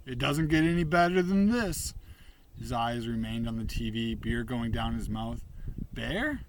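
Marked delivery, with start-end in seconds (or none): 0.1-1.9 higher, louder slogan delivery; TV announcer. A narrator's reading. Bear parrots a TV slogan. 5.9-6.4 high, rising call of a name; questioning call. A narrator's reading. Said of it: "desperation clung to my voice".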